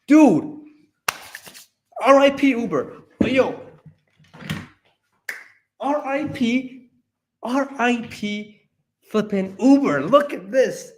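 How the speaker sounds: high, thrilled and slowed on R I P; real giddy excitement, voice way up; excited. Real, not acted. Excited viewer celebrating the Uber news, high and thrilled. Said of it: "sorry, I'm very excited".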